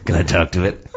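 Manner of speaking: lightly voiced low creepy masseur.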